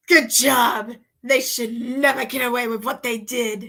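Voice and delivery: loud gloating villain. Pretend. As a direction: sneer it like a cartoon villain. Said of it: "I went from sounding like a villain".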